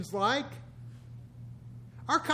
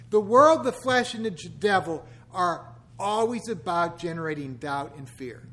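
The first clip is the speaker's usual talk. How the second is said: normal talking voice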